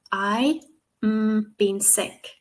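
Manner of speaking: hummed gap between I and BEEN; pause at mistake. Shown as a demonstration. Teacher showing where the mistake is, a hummed pause after I. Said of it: "pause so that they know".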